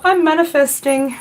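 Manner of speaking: high, put-on mimicry; mock manifesting Leo. Card reader playing Leo manifesting, mock high voice. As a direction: pitch it up as a playful send-up.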